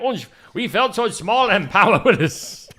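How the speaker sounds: hammed-up German accent; mock German accent. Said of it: "trying to do a German accent".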